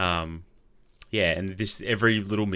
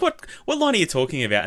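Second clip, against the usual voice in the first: mock disbelief; 7 semitones higher, 6 dB louder than usual. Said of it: "I'm like".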